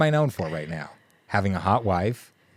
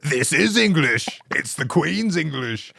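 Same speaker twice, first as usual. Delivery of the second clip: slow, high, indignant mock-British; drawn-out British protest, hammed up; overdone Queen's English impression; British accent; 6 semitones higher, slower than usual. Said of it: "Please speak English".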